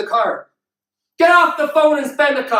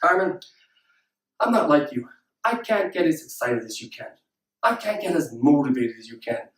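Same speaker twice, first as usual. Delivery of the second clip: low, soft, deflated mock voice; unenthusiastic objection; 9 semitones lower, 5 dB quieter than usual. Doubting audience member excusing themselves to the speaker, unenthusiastic. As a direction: mimic a listener who can't get excited. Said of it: "I know what some of you are thinking".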